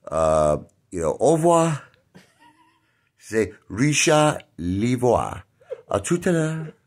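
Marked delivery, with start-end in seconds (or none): 1.2-1.8 lightly mocked French "Au revoir" lifted high; a quick French-teacher "Au revoir"; mock French teacher bidding goodbye; imitating French teacher. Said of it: "My French teacher, she says". 3.7-6.7 slow, sing-song mock French accent; mock French teacher calling the roll; imitating French teacher. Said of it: "My French teacher, she says".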